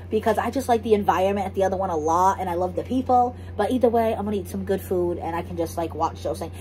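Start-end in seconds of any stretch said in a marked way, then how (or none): none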